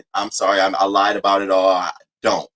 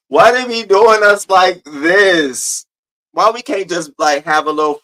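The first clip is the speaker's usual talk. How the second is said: higher, louder, slowly drawn-out whine; mock lament; 7 semitones higher, 5 dB louder, slower than usual. Streamer playfully complains to the chat, slow and wailing. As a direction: stretch every word out like a theatrical complaint.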